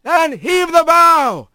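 high, slow call from the boss across the deck; straw boss calling out. Acted. Straw boss calling the crew to cast off, high drawn-out call. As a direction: bellow the order to cast off, drawn out. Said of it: "came the straw boss's call".

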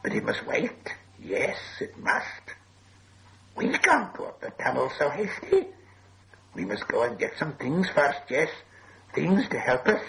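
hissy creature voice, breaking up high; wheedling cave creature; hissing Gollum. A narrator's reading. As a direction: stall slyly in a sibilant creature voice. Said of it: "hissed Gollum".

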